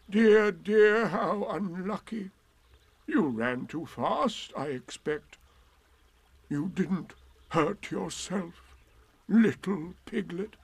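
slow, concerned donkey voice; Eeyore. A narrator's reading. Eeyore asks if Piglet hurt himself, slow concern. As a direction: draw the sympathy out slowly.

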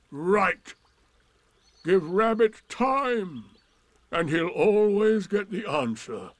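big scornful donkey voice; sarcastic Eeyore. Acted. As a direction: sound heavily put-upon and ironic.

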